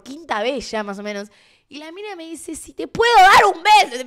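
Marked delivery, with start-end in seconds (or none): 2.5-4.0 loud, high shouted question; retelling the girl shouting over music; retold shout. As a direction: yell it across a noisy party. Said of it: "Me lo grita".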